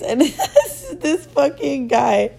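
breaking into laughter, slowing right down; laughing. Real, not acted. Telling friends about the shirtless guy, laughing. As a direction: laugh through the words as you point him out.

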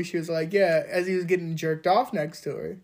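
normal talking voice

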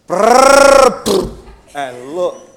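loud revving brrr, high, then a laughing poo; loud motorbike noise. Retold or imitated. Showing the gangster on his motorcycle, a loud engine roar. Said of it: "gangster type of fellow".

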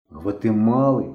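plain reading voice

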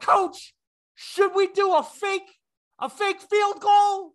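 high, drawn-out shouted question; player yelling. Acted. Player asking his coach in full view, yelling. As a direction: holler it across the field, word by word. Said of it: "literally looked and yelled".

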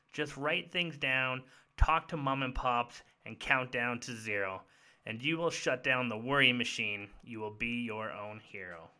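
put-on reading a children's story aloud; rhyming storybook cadence; story narration. Reads the book's ending aloud.

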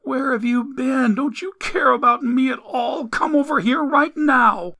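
high, upset demanding woman's voice; scolding mother. A narrator's reading. Mother reproaches Ellie from her bed, high angry scolding. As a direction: scold high, building to an order.

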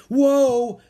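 high, loud yelp of alarm; startled cry. Acted. Annie almost falling backwards on the steps, startled cry. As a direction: cry out as you lose your footing.

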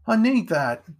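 urgent demand. A narrator's reading. Boy demands his knife back.

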